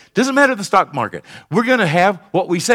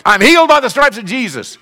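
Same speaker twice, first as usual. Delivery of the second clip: higher and louder, bold; loud confident declaration; 7 semitones higher, 5 dB louder than usual. Shown as a demonstration. Pastor showing how boldly they should say it. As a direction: declare it like you mean it. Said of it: "It's not like".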